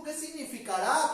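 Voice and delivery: ordinary talk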